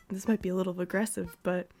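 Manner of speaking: ordinary delivery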